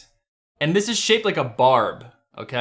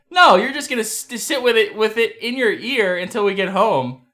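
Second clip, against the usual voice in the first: higher-pitched imitation of a dismissive wife; mock wifely scolding, pitched up; retold objection; 6 semitones higher, 3 dB louder than usual. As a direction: play the wife shooting the idea down.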